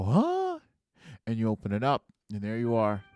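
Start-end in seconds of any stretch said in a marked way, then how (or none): 0.0-0.6 high-pitched, rising confused yelp; acted startled question; puzzled reaction. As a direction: pitch jumps way up in mock bafflement. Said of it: "and you go".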